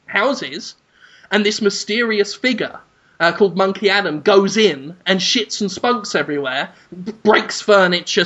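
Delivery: ordinary talk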